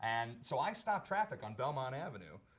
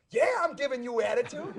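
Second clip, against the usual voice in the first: high, loud mock shout with defiance; shouting back; 12 semitones higher, 12 dB louder than usual. Actor in character shouting back at the cop, defiant mock yell. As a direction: yell it back cheekily across the street. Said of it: "I shout back".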